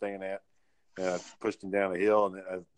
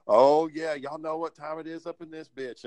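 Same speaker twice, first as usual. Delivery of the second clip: higher, loud then sly boast; strutting boast; 5 semitones higher, 4 dB louder than usual. Retold or imitated. Retelling a cop showing off her fur coat, sassy boast. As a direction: strut in and brag, loud at first.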